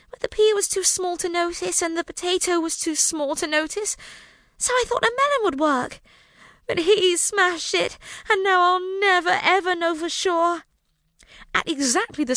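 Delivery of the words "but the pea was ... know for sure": put-on high childlike wail. A narrator's reading. Rosalind wailing to the King and Queen. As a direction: plead it like a let-down child. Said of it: "Rosalind wailed".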